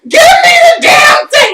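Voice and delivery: high, loud screech of a demand; frantic yell. Retold or imitated. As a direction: screech it in desperation, acting out the coworker. Acts her frantic demand at him.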